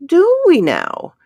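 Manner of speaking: high, loud, slow mocking doubt; sceptical retort. A narrator's reading. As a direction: throw the question back, doubting. Skeptical retort in story.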